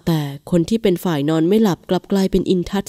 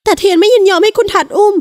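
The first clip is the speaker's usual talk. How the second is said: put-on much higher and louder young woman; insist, higher and louder; protesting wife; 13 semitones higher, 7 dB louder than usual. A narrator's reading. Wife refusing her husband's hold: higher, louder protest.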